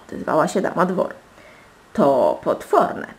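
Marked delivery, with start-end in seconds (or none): 1.9-3.1 slow, louder horrified verdict. A narrator's reading. Lady-in-waiting reporting the swineherd's demand, horrified. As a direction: draw it out in scandalised horror.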